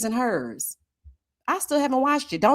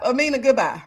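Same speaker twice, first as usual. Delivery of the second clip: normal talking voice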